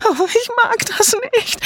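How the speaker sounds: very high, frightened whimper; panicked wail. A narrator's reading. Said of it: "alle riefen wirr durcheinander".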